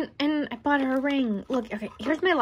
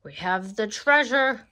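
ordinary delivery

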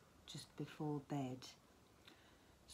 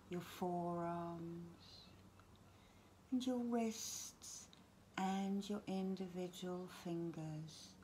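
guided relaxation. Acted. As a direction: gently talk them down towards sleep, phrase by phrase. Leads a bedtime relaxation.

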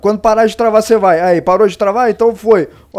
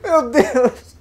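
real laugh breaking into a whimper; helpless laugh-sob at the game; laughing crying; 6 semitones higher, 6 dB quieter than usual. Real, not acted. Gamer to himself after failing, laugh-crying.

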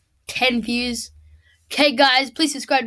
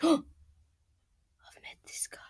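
slow whisper after a gasp; gasp, then whisper it slowly; awed whisper; 4 semitones higher, 8 dB quieter, much slower than usual. Real, not acted. Gamer recognizing the shopkeeper, hushed slow whisper. Gasps seeing dangerous shopkeeper.

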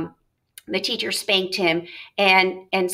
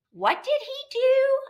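much higher, slow, curious mom voice; mother asking; slower than usual. Acted. Teacher reading the mother asking her son, high and slow. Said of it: "I asked again".

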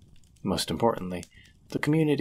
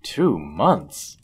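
astonished; 8 semitones higher, 5 dB louder, slower than usual. Acted. Host reacting to the traveler's two months on foot, astonished. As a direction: pitch jumps high on whatever for, louder. Listener reveals long lonely travel.

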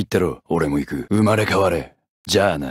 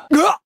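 pained yelp; 5 dB louder than usual. Acted. Character struck in battle.

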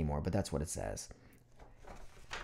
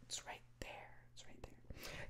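fading whispered echo; 30 dB quieter than usual. Pretend. Whispered echo of own words.

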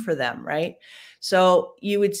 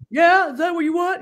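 high, louder mock English accent; imitating an English accent, raised pitch; 13 semitones higher, 5 dB louder than usual. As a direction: put on a posh-sounding English accent to tease. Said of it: "And then he'd do an English accent".

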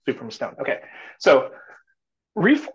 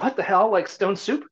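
incredulous; 6 semitones higher than usual. Retold or imitated. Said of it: "thinks like".

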